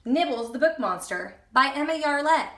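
ordinary delivery, nothing marked

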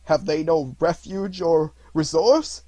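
put-on loud, slow, pleading old man; anguished cry. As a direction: plead out in horror. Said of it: "cried Scrooge".

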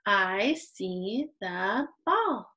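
very slow "I see the ball", then an audible little breath; breath at period. Shown as a demonstration. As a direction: read "I see the ball" stretched out, stop, breathe. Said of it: "It doesn't have to be a big breath".

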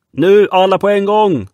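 high, loud heave order; commanding shout. Acted. Red Nelson ordering the crew to heave. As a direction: call all hands to pull together. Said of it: "började Röda Nelson om igen".

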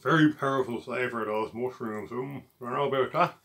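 speaking between bites; talking while chewing. Real, not acted. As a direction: mouth partly full of pizza. Seen 1: Holds slice at mouth, bites and chews while speaking throughout.

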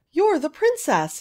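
high, loud shout of surprise. Acted. Kit realises who she is, shouting in surprise. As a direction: shout it out, startled by the discovery. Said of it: "he shouted".

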